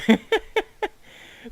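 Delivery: loud squealing laugh; high burst of laughter. Real, not acted. Laughs at the bridge height.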